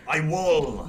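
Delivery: loud Scottish I WILL; a burst of Scottish on WILL; Scottish accent. Pretend. Listener offering another threatening accent, playful Scottish accent. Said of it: "Or Scottish".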